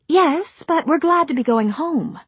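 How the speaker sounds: put-on high, bright young actress. Rosemary speaks.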